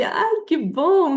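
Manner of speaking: mock desperate approval. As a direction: imitate a husband hiding panic behind slow praise. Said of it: "Tipo, desesperado". (aprovação forçada, imitando desespero)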